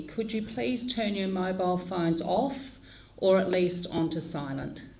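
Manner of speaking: ordinary talk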